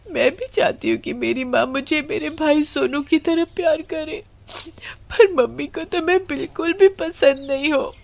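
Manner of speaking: put-on sobbing high-pitched little girl; tearful child. Hungry Komal weeps alone under the tree about her mother, sobbing. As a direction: cry through the words in a high child's voice. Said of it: "भूखी बैठी रोती रहती है".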